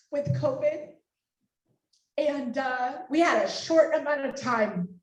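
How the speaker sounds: real tearful, halting voice; emotional. Real, not acted. Organizer recalls to donors how the event nearly failed, tearful. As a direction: speak through tears with pauses to hold it together. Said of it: "sorry if I'm emotional".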